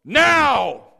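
loud, sharp bark of a command; firm shouted order; authoritative command. Retold or imitated. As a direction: snap it out with full authority. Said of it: "The dog can sense the authority in my voice".

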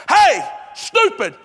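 very high, loud yells, one command at a time; mock urgent shouting. Preacher acting his GPS yelling at a zoned-out driver, frantic shout. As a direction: shout like a GPS that has lost all patience.

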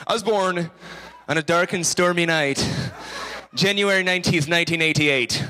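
lightly voiced old sea dog; sailor voice. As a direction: tell it like a salty sailor spinning a yarn. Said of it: "That's my sailor voice".

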